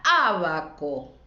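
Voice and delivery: abaco with a strong, stretched opening A, ÁbAco; stress on first syllable. Shown as a demonstration. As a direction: lean long on the first A of abaco. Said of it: "¿Cuál digo más fuerte?".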